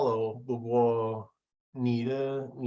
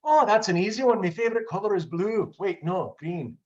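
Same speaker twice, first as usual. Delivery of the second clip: higher-pitched playful Irish lilt; Irish accent; 7 semitones higher, 3 dB louder than usual. Pretend. Speaker answers quiz on favorite color, Irish accent. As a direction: put on your best Irish accent. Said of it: "in your best Irish accent".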